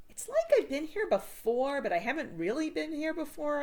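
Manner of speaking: musing about déjà vu; puzzled Joe. A narrator's reading.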